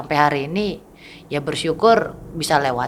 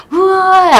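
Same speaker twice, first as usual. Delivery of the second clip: high yelp; 12 dB louder than usual. Retold or imitated. Recalling her own scream.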